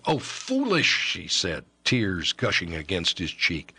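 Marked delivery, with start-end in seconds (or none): 0.1-0.9 tender rebuke. Acted.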